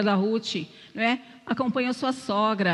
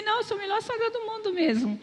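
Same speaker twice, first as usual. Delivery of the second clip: much higher, insisting with a playful grin; emphatic insistence; 11 semitones higher than usual. Retold or imitated. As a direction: say it again and mean it, pitched up high. Insists again after he laughed. (repetindo com ênfase, bem mais aguda e divertida)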